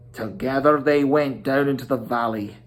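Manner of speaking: ordinary delivery, nothing marked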